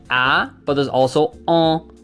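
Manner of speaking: "on" said through the nose; nasal vowel. Shown as a demonstration. Teacher contrasting a plain vowel with nasal "on". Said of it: "nasalized counterpart".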